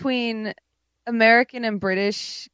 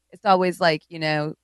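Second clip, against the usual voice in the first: ordinary talk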